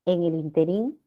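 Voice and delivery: interín stressed on the last syllable, rising; interín said as in-te-RÍN; common mispronunciation. Retold or imitated. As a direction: lift and lengthen the RÍN in interín. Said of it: "si se pronuncia así".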